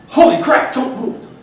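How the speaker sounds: high, loud, breathless alarm; delivery guy startled by a squirrel behind his customer; alarmed pizza guy. Retold or imitated. Pizza guy panics about squirrel.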